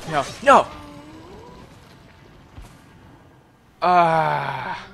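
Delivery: loud high cry of despair; anguished shout. Real, not acted. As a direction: wail as you die. Killed in game.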